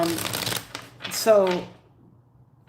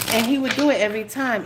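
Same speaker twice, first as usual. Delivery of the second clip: usual speaking voice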